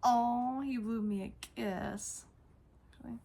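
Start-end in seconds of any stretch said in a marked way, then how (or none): none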